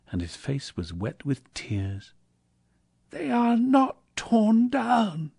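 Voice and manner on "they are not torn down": high, slow cry of relief; overjoyed Scrooge, pitched way up; joyful cry. A narrator's reading. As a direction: cry it out high, drawing out the words. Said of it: "cried Scrooge".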